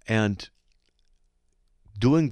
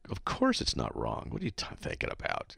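lower, mildly scoffing imitation; dismissive mock snicker; 6 semitones lower, 6 dB quieter than usual. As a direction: lightly mimic someone snickering at a silly claim. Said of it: "with a snickering kind of a".

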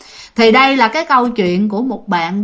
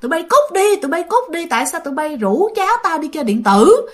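grandfather yelling; 6 semitones higher than usual. Retold or imitated. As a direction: chase the kids away, voice raised high. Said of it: "mà la vậy".

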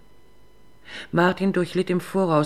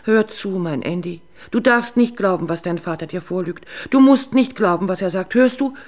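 pleading mother; 3 semitones higher, 7 dB louder than usual. Acted. Drunk mother pleads with son.

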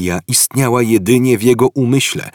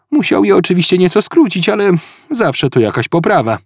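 higher, quick apologetic character voice; Ford explaining; 6 semitones higher, 3 dB louder than usual. Acted. Ford excuses the short entry to Arthur, sheepish voice.

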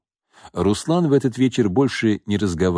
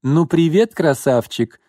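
high, languid flirting; 8 semitones higher, 4 dB louder, slower than usual. Acted. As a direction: purr it seductively. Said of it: "томно произнесла девушка".

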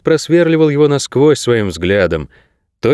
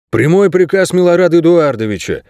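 stubborn guard; 7 semitones higher than usual. A narrator's reading. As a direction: insist in a higher voice. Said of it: "упрямствовал дежурный". (упрямо, с нажимом)

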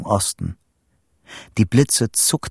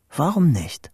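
higher voiced repeat with a falling end; voiced, resolved thought; 6 semitones higher than usual. A narrator's reading. Boy daring himself under storm clouds, voiced and falling. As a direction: say it higher, deciding on it.

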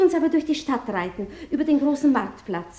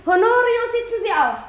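higher call across to Honorio; 8 semitones higher than usual. Acted. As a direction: summon him brightly by name. Princess calls Honorio to mount. (Fürstin ruft Honorio zu, höher)

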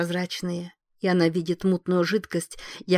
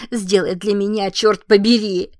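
fierce urging; 2 semitones higher, 8 dB louder than usual. Acted. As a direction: demand it fiercely. Wife urges dying husband. (яростная мольба)

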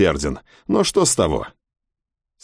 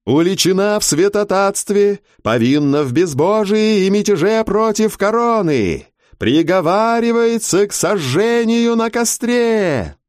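booming, slow herald's call, much higher; town crier; 10 semitones higher than usual. A narrator's reading. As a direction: call it across the square like a herald. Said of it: "зычный голос городского глашатая".

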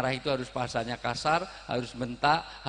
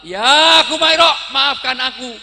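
high, hammed-up cry for forgiveness; shouted apology; 12 semitones higher, 17 dB louder than usual. Husband asks wife's forgiveness, acted loud plea. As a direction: belt out the apology, slow to start.

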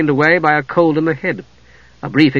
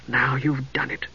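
softer, slower, hushed dismay; whispered; 9 dB quieter than usual. A narrator's reading. Palin hushed to Steele after he gives his name, whispered. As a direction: breathe it under your breath. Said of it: "he whispered".

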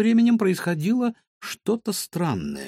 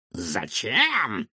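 taken aback, pondering; 3 semitones lower than usual. Acted. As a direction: caught off guard, thinking it over. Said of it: "Доктор осёкся и задумался".

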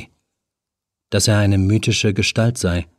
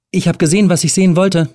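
lightly raised nervous woman's voice; a young woman caught trespassing, higher; Helen, nervous; 8 semitones higher, 4 dB louder, faster than usual. A narrator's reading. Helen caught in the flat, talking to the stranger, higher and nervous.

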